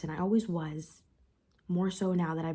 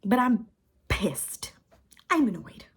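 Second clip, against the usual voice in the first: louder, higher, pushing out each word; real frustration; 5 semitones higher, 8 dB louder than usual. Real, not acted. Venting to camera about hating herself, loud frustrated anger. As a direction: let the anger rise through every repeat. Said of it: "as you can see".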